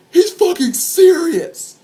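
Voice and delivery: high, slow-stretched exclamation; retold cry of amazed alarm; alarmed disbelief. Onlooker seeing a man about to jump, incredulous.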